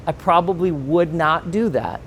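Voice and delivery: ordinary talk, nothing marked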